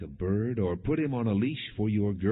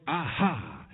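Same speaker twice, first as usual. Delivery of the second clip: a sudden, higher snorting cry; horse's snort; 5 semitones higher than usual. A narrator's reading. War horse hearing the trumpet, higher snorting cry.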